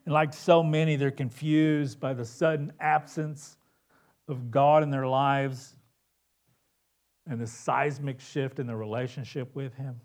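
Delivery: normal talking voice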